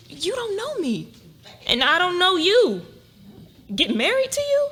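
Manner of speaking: rising protest against the absurd offer; incredulous. Acted. Incredulous at marriage proposition.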